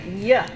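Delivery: Y said as a short clear y-glide; demo y sound. Shown as a demonstration. Teacher models the y sound for students to copy. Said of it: "So everybody try it".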